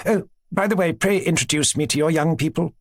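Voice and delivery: a hint of a gushing princess; lightly voiced chatty princess. Princess telling her aunt about her children, lightly gushing.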